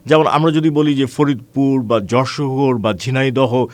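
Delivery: ordinary delivery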